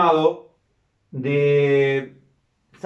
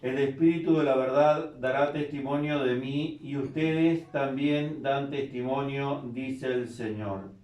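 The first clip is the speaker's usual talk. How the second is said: soft, measured scripture reading; liturgical reading; 3 semitones lower, 8 dB quieter than usual. Acted. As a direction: read it like a priest at mass. Reads the gospel verse.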